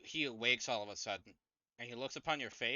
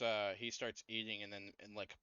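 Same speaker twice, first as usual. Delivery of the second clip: ordinary talk, nothing marked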